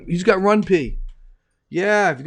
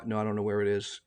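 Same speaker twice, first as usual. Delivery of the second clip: ordinary talk, nothing marked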